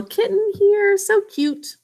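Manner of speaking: high, cooing little voice; cutesy kitten voice. Shown as a demonstration. Teacher showing a kitten, high cutesy voice. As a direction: coo in a small high pitch.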